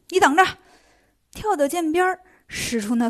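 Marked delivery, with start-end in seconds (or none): 0.1-0.4 loud, high snap of rage; the monkey loses his temper and yells; angry shout. A narrator's reading. Monkey King shouts at the monk, furious. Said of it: "又急了".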